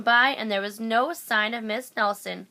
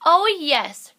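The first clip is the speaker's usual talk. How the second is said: loud, high burst of joy; delighted cry; 8 semitones higher, 10 dB louder than usual. A narrator's reading. Kids thrilled at the offer of story hour, crying out in delight. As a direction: cheer it out. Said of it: "cried the kids".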